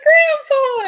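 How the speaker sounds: put-on high, loud kid's shout; excited child. Boy running to his grandpa, excited child voice. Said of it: "he just runs to his grandpa. He's like".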